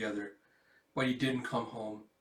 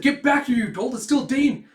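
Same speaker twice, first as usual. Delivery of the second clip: shouting; 12 semitones higher, 12 dB louder than usual. Acted. Adult yells after child.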